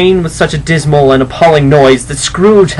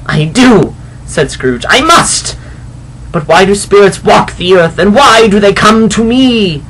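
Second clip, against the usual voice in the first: higher, louder, panicked pleading; frightened Scrooge; 7 semitones higher, 4 dB louder than usual. Acted. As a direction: cry out at the phantom in fear. Scrooge terrified, begging.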